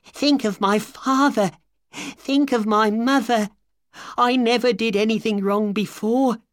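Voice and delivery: high, rising plea that climbs line by line; desperate pleading. A narrator's reading. Thief on the floor begs Holmes to spare him, desperate high pleading. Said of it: "cried Ryder".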